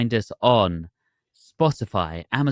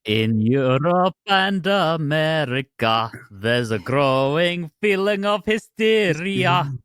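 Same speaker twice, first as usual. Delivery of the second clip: slow, raised-pitch Russian accent; 6 semitones higher, much slower than usual. Shown as a demonstration. Co-host quoting a lyric for fun, Russian accent. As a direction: draw it out in a put-on Russian accent. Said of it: "do that in a Russian accent".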